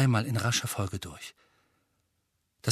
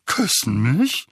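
Onkel Vernon, baffled; 4 dB louder, slower than usual. Acted. Uncle takes in the idea of kissing, baffled. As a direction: repeat it slowly, puzzling over each word.

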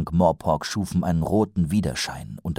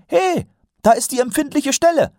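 very high, louder exclamation; excited call; 5 dB louder than usual. A narrator's reading. Recruit demonstrates shouting at a dragon's weak spot, excited call. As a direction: call it out as if spotting the target.

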